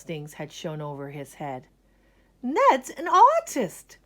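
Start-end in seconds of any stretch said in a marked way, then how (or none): 2.4-4.0 high, loud, slow announcement; delighted teacher. Acted. Art teacher proudly declares Ned an artist. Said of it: "she said".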